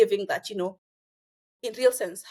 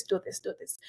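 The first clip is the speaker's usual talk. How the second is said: unvoiced whispered "do this, do this"; 2 semitones lower, 7 dB quieter than usual. Real, not acted. Host advising students, echoing it in a whisper. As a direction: drop to a whisper for the echo. Repeated advice, whispered echo.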